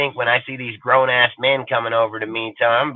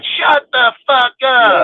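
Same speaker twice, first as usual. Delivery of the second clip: angry shout; 9 semitones higher, 6 dB louder, much slower than usual. Retold or imitated. Yelling at intruder in house.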